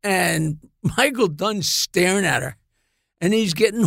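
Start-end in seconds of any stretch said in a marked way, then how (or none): none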